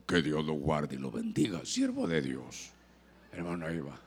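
mock a fake saint, low and solemn; fake pious voice. Preacher mocking a showy churchgoer, low hushed pious drone.

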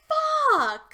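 sudden high loud mock curse; inner fuck. Telling friends how she reacted in her head, loud mock curse. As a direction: blurt it out in comic dismay. Retelling shock at meeting him.

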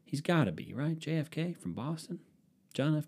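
usual speaking voice

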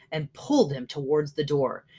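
usual speaking voice

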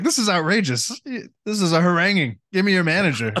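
high, indignant, prissy complaint; prim outraged snob. Retold or imitated. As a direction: huff like a pampered aristocrat. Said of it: "That sounds like some Coruscant talk".

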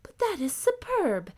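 high, bright-pitched princess exclaiming; delighted little princess. A narrator's reading. Princess voice in story.

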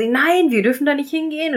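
mock Branson forbidding her; voicing Branson. Branson voiced in retelling.

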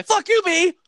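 high, loud mock shout at a bee. Yelling at passing bee.

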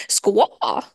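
a mock crow squawk; crow caw. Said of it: "looks me in my eye and goes".

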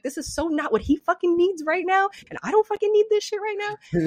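exasperated venting, retold; retold anger. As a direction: re-enact being mad, voice climbing. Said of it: "I was so mad".

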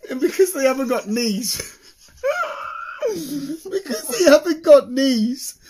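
cracking up mid-sentence, voice squeaking high; can barely get the words out for laughing. Real, not acted. Telling his mate the penguin joke, helpless laughter.

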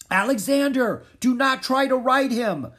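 very high, loud warning shout; yelling. A narrator's reading. King Philip warns the prince off the stallion, yelling. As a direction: yell an urgent order across the field. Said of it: "King Philip yelled at the prince".

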